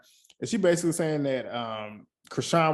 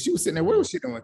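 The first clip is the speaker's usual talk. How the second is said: high-pitched disbelief, rising louder; incredulous; 5 dB louder, faster than usual. Real, not acted. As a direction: ask it in shocked disbelief. Incredulous rant about Sky.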